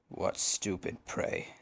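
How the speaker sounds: low, slow, hushed wolf voice; scheming wolf. A narrator's reading. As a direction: think it to yourself, low and drawn out. Wolf privately sizes up her prey.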